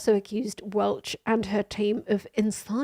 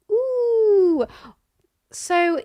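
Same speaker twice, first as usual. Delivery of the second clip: normal talking voice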